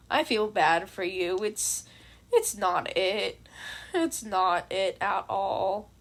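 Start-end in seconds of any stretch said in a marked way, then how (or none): none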